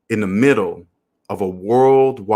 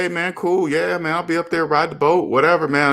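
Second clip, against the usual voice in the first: higher, forced cheerful go-along; fake agreement; 8 semitones higher than usual. Holding his tongue with a friend, forced cheerful and higher. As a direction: pretend to be fine with it, pitched up. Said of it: "I just gotta be quiet and say".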